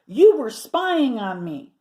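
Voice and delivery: loud, slow accusation; slow loud indignant charge; accusing. Acted. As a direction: nail him with each word. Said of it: "she cried".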